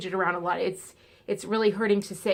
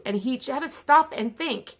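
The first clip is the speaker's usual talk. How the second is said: ordinary delivery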